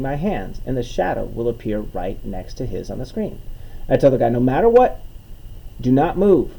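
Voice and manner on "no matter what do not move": loud, raised, emphatic command; firm instruction. Retold or imitated. Comedian instructing his volunteer on stage, firm command. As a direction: lay down the rule, louder and higher.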